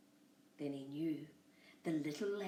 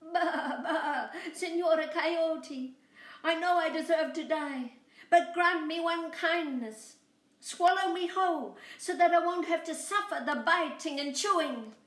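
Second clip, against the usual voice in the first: high bleating lamb voice, louder; 9 semitones higher, 6 dB louder than usual. Acted. Lamb tricking the coyote, high bleating voice. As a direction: bleat the words like a little lamb. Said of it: "Boragita bleated".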